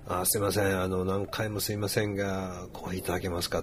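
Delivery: bow and scrape over a simple coffee order; overly polite request. Shown as a demonstration. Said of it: "そげなことをね、補足してしまうとね".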